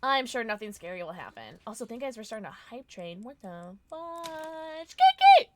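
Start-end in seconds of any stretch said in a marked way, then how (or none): 3.2-4.9 slow, puzzled mock surprise; drawn-out what. Real, not acted. Startled drawn-out exclamation. 5.0-5.5 very high, loud excited squeal. Real, not acted. Excited greeting to viewer.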